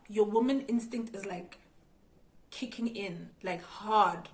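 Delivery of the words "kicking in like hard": normal talking voice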